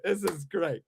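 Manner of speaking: sarcastic fan saying it's great; mock fan praise. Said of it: "is gonna wanna be like".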